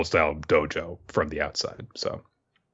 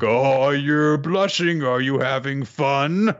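slow, higher, louder sly teasing; mocking teasing; 6 semitones higher, 3 dB louder, slower than usual. Father taunting his blushing son mid-spar, slow mocking tease. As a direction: drawl the tease to needle him. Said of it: "Ninokuru's father is like".

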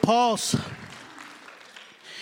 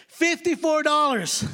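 indignant disbelief; 6 semitones higher, slower than usual. Real, not acted. Outraged at fifty-four dollar price.

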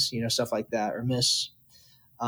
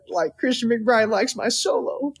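awestruck; 8 semitones higher than usual. Retold or imitated. Overwhelmed after McBride's praise.

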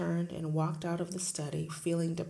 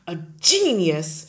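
loud scornful shout; 15 dB louder than usual. Acted. Headmistress shouting at the teacher, loud. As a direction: bellow the word in disbelief. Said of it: "she shouted".

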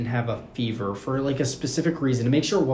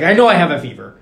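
loud, higher mock outburst; pissed-off roommate; 5 semitones higher, 12 dB louder, faster than usual. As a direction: imitate a roommate insisting angrily. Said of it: "really getting pissed off".

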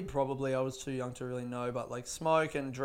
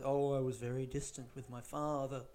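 mock therapy-speak; 3 semitones lower, 7 dB quieter than usual. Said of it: "It's like that class".

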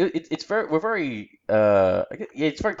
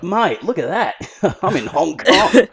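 put-on loud, high, excited Aussie accent; broad Australian tourist breaking into a laugh; 5 semitones higher, 9 dB louder, slower than usual. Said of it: "that's the voice I use when I go overseas".

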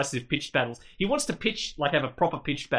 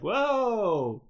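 high startled gasp of a word; startled whoa; 8 semitones higher than usual. Retold or imitated. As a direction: jump at the sirens. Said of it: "he's like".

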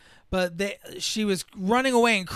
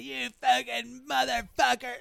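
mock fury; 6 semitones higher than usual. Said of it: "she gets furious".

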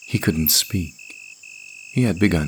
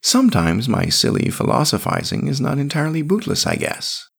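lightly voiced character, pitched higher; high voice with a touch of delight; 8 semitones higher than usual. A narrator's reading. Grinning companion muses on own philosophizing, mildly delighted.

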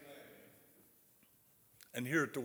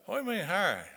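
higher-pitched puzzled question; retold bewildered question; puzzled church lady; 6 semitones higher, much faster than usual. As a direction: lightly imitate a baffled church lady. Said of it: "understanding my, my southern accent".

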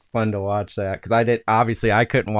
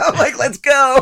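laughing; 4 dB louder than usual. Retold or imitated. Laughing mock rally cry.

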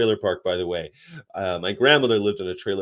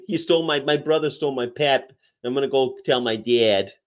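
higher, whining tattletale with Chicago vowels; mock Chicago accent; 4 semitones higher than usual. Kid telling on brother over pop, mock Chicago accent.

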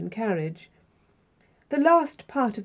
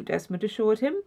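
usual speaking voice

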